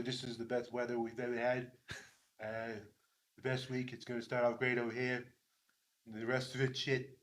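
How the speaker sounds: lightly voiced Trump boast; Trump impression. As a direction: brag low and quiet like Trump. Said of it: "that's my Trump".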